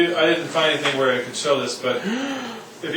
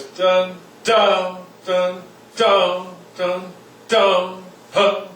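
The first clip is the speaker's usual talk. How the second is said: each dun punched then falling off; accent mark demo; accented notes; 3 semitones higher, much slower than usual. Shown as a demonstration. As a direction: hit dun with oomph and let it drop. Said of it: "put some oomph behind it".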